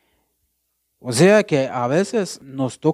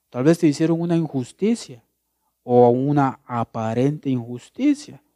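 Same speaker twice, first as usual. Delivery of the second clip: ordinary talk, nothing marked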